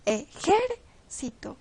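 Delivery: ejército split, JÉR punched; slow ejército, weight on JÉR; stress on antepenultimate syllable. Shown as a demonstration. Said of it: "en la antepenúltima".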